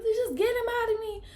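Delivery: very high, strained voice at normal volume; imitating a desperate labor plea; retold strained plea. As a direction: squeeze it out high, holding back the yell. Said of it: "I said".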